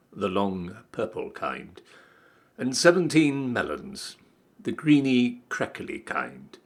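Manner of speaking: usual speaking voice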